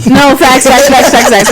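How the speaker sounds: rapid, loud, high agreement through laughter; laughing agreement. Real, not acted. Guest agreeing with the host's quip about repeat business, laughing. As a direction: agree loudly while cracking up.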